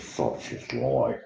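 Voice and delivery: a hint of a slow, soft, stoic Aussie woman; mild slow "Such is life" shrug. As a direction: lightly play the resigned local, unhurried. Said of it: "The Stoic Aussie woman says".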